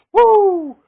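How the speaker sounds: shrill high loud "woo!" yell; Ric Flair woo. Retold or imitated. Imitating Ric Flair's woo while previewing the title match. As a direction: belt out a wild high woo. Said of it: "Shut up, Nature Boy".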